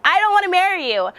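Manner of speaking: high, loud retold yell. Yelling at the guy sent to escort her, high loud yell. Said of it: "just yelled at him".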